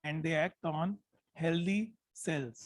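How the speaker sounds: slow dictation. Shown as a demonstration. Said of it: "मैं जानबूझ के धीरे बोल रहा हूँ".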